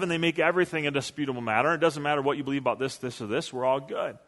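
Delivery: fast, dropping to soft and shrugging; mock carefree brush-off. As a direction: rattle it off like nothing matters. Mocking the everything-goes view.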